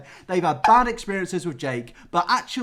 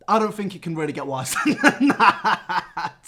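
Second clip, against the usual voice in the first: laughing; 5 dB louder, slower than usual. Real, not acted. Laughs while speaking.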